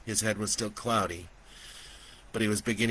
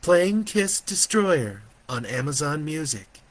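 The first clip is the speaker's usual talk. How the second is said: higher, perky voice assistant; lightly characterful AI voice; AI with personality; 6 semitones higher, 5 dB louder than usual. A narrator's reading. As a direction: announce it like a virtual assistant. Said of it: "with a hint of personality".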